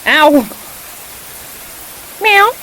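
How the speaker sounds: loud high yelp, then a loud meow; yelp and mock meow. Real, not acted. Cat scratches, she yelps.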